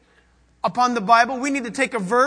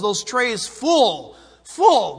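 "full" drawn out slowly, then "full" punched louder; stress on full; 4 dB louder, much slower than usual. Real, not acted. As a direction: lean on "full", then hit "full" hard. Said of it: "stressing full".

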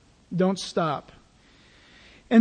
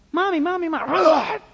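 put-on high, whining little kid calling mommy; whiny child; 5 semitones higher than usual. Child pestering a parent, whining high for mommy. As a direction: nag like a pestering child, pitched way up. Kids pestering, pastor snaps.

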